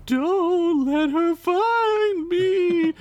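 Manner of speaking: very high, slow ghostly wail; eerie high ghost lady; spooky lady voice. Acted. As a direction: drift it out like a ghost woman. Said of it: "the spooky lady voice rang".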